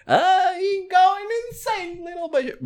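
high, slow mock accent; goofy high mock-accent voice; silly put-on voice.

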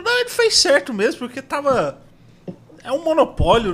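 high-pitched politician impression; Zé Carlos do Pátio. Guest quotes politician explaining the Globo attack, high put-on voice. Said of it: "Aí ele foi, falou".

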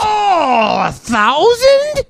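high, drawn-out disbelief; shocked. Real, not acted. Friend shocked at the loan, high drawn-out disbelief. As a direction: gasp it out in disbelief. Shocked reaction to borrowed sum.

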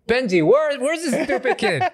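very high, laughing mock fury; mock outrage through laughter. Dad mock-angry at a clever toddler, laughing high. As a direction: squeal it in fake anger while cracking up. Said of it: "I was like".

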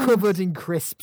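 loud, slow, high jeer; scornful rant. Real, not acted. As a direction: finish the jeer with drawn-out scorn. Finishes rant at fantasy nerds.

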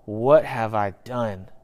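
slow, low question to oneself; regretful self-questioning. Acted. Sinner looking back on own decisions, understated regret. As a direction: let the realization sink in slowly. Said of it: "we have thought to ourselves".

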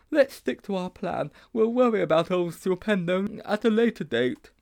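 put-on high boyish character voice; Sponge persuading. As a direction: urge the plan in a high schoolboy voice.

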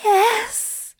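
very high moaned assent; moaning. A narrator's reading. Aziraphale answering lover, moaning. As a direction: moan it out, high. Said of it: "Aziraphale moans".

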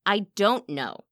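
earnest, emphatic denial; protesting. A narrator's reading. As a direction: lean hard on "don't" in protest. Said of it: "she protested".

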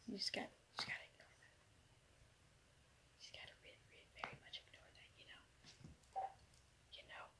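hushed, almost voiceless aside; whispering. Real, not acted. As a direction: drop to a whisper. Trails into whisper.

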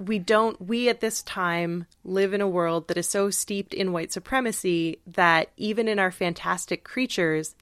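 ordinary talk, nothing marked

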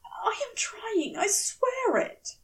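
high, fussy, apologetic angel voice; a bewildered gentleman pitched high; flustered angel. Acted. Aziraphale pleading.

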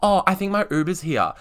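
high-pitched, awkwardly imitated excuse. Making an excuse to the crush to leave, awkwardly. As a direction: make a flustered excuse to escape. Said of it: "I was so awkward that".

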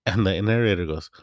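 usual speaking voice

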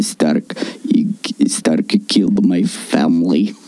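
hammed-up Eastern European villain, brooding slowly; Eastern European accent. Villager doing a mock Russian lament for his family. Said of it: "how do you do an Eastern European accent?".

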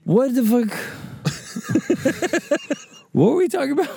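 squeaky laugh-choked what the fuck; high laughing disbelief. Real, not acted. Laughing confusion.

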